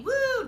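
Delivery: high, loud "woo"; mock daredevil cheer; thrilled whoop. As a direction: whoop as if leaping from a plane. Acts out jumping from plane.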